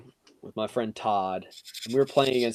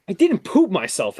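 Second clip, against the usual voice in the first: indignant denial; 6 semitones higher, 5 dB louder than usual. Retold or imitated.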